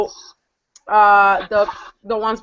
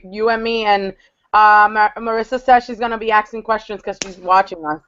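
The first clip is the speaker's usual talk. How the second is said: normal talking voice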